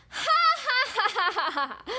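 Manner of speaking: big high laugh, descending; pig's mocking laugh. Pig laughing at the frightened wolf, loud stagy laughter. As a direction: belt out a stagy laugh that falls away. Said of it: "laughed the little pig".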